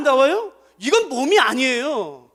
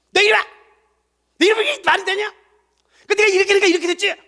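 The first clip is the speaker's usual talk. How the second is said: higher, louder scolding, fast at the end; scolding rebuke; 9 semitones higher, 5 dB louder, faster than usual. Acted. Pastor acting a gifted rebuker scolding a church member, angry. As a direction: bark out a rebuke at a wrongdoer. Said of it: "가장 잘하는 게 책망이에요".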